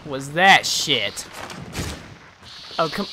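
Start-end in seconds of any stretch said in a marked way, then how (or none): none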